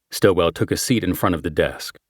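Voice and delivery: ordinary delivery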